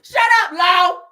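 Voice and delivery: loud, high mock shout; imitating her yell. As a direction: yell it like she does at her kid. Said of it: "She hollering at Law".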